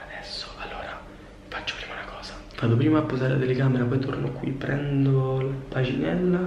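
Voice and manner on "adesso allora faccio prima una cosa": hushed whisper, not to wake him. Real, not acted. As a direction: whisper so nobody wakes. Sneaking up on sleeping friend.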